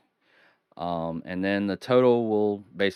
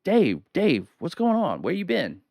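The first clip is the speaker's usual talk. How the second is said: a lightly voiced curious fan, pitched up; imitating a viewer; 9 semitones higher than usual. Host mimics viewers asking where he went, in a mildly pitched-up voice. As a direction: ask it like a puzzled fan who missed you. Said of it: "you may be wondering".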